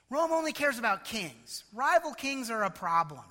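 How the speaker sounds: ordinary delivery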